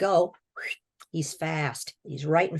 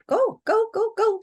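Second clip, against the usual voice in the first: much higher, loud "go, go" yell; shouted orders; 5 dB louder than usual. Retold or imitated. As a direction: bark him out of the hallway. Demon orders him off.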